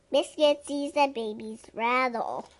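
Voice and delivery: plain reading voice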